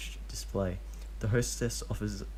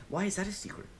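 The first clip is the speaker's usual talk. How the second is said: put-on high little boy's question; curious child; 12 semitones higher, 4 dB quieter, faster than usual. A narrator's reading. Child Deidara suddenly asking Obito about his mask, high curious voice. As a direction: ask like a nosy kid. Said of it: "barrage of childish questions".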